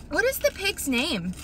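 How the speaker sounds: ordinary talk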